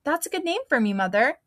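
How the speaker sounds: high, eager burst of an idea; light up with the idea; real excited. Acted. Girl discovering her new clown name, excited. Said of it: "real excited".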